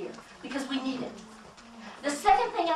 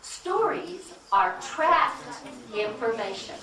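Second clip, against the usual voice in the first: slow, weighing every word, "trapped" leaned on; slow deliberate repeat; slower than usual. Shown as a demonstration. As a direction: spell it out slowly like a key line. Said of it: "Say that again".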